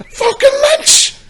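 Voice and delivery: shocked shout; surprised shout. Retold or imitated. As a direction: yell his name in shock at the door. Surprise at door.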